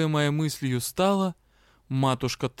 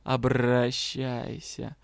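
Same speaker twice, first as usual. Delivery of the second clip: purred reply; 2 semitones lower, much slower than usual. A narrator's reading. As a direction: purr it lazily back. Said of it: "промурлыкали в ответ".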